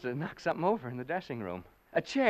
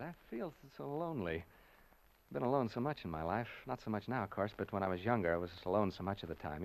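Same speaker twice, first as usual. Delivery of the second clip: much softer, sinking into memory; lonely, subdued; 6 semitones lower, 10 dB quieter, faster than usual. Acted. Announcer remembering a lonely youth, going soft. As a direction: let the loneliness pull the voice down. Lonely reminiscing in dark house.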